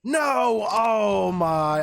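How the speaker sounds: drawn-out real cry of disbelief; slow anguished shout. Real, not acted. Player finds every resource deleted, anguished shout. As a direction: wail it out slowly.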